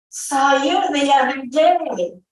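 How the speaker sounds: high, swooping boom. A narrator's reading. Burly soldier to little Jephthah, booming. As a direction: call it out big to a small child. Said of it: "the burly man boomed".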